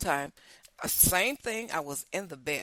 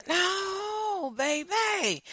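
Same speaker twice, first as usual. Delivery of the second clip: playful put-down with a raised voice; sassy refusal; 11 semitones higher, much slower than usual. Real, not acted. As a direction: brush them off with a teasing lilt.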